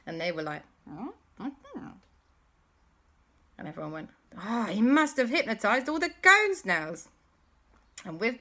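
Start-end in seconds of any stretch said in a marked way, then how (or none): 0.9-1.9 high, very soft little voice; confused snails. Acted. 4.4-7.1 loud, high "oh" of discovery; sudden realisation. Acted. Everyone realises together.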